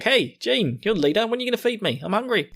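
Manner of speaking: high-pitched complaining; Sasha. Retold or imitated. Sasha complaining to Jean, high mock whine. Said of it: "Sasha immediately starts complaining".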